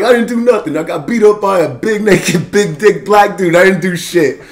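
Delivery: protesting innocent man. Retold or imitated. Arrested man protests being beaten up, mock indignant. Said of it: "he's getting dragged away".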